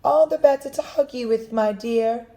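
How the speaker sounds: high, quick sly wolf voice; the wolf purrs a quick fake sweetness; wicked wolf. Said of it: "the wicked wolf replied".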